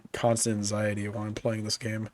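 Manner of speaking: ordinary talk, nothing marked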